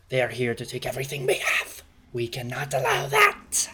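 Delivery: put-on rally the kobolds like an old witch. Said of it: "the witch says".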